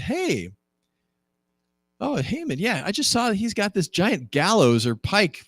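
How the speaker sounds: high-pitched, singsong tattling voice; chatty eunuch informant. Acted. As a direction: pipe up like a helpful tattletale. Voicing a eunuch tattling to king.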